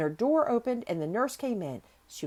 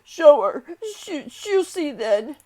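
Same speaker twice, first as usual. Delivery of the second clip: loud, high broken outburst; gasping between sobs; 9 semitones higher, 12 dB louder, slower than usual. A narrator's reading. Sobbing boy demanding nurse show Mary, gasping outburst. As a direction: blurt it out loudly, stumbling between sobs. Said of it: "he gasped out between two sobs".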